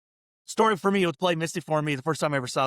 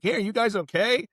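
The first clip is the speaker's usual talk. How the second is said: worried neighbor; 8 semitones higher than usual. Retold or imitated. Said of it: "people who are like".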